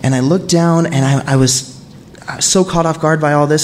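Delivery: ordinary talk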